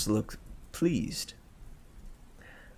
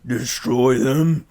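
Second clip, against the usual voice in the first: slow, very loud dumb brute; dim brute voice; 9 dB louder, slower than usual. A narrator's reading. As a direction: boom it out like a thick ox.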